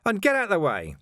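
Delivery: high, forceful order; impatient, indignant command; angry demand. A narrator's reading. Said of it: "he demanded".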